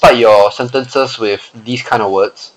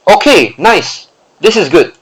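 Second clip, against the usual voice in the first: impressed; 6 semitones higher than usual. Real, not acted. Praises the sentence.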